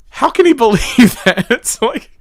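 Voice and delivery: high, laughing question; laughing disbelief. Real, not acted. Host ridiculing what the politician believes, laughing. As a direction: crack up mid-question.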